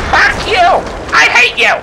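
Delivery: very high, squealed mock kid shout. Kid shouting at his mom, high-pitched mock tantrum. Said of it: "The kid shouts".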